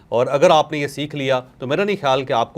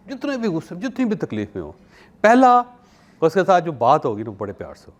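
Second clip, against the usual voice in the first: usual speaking voice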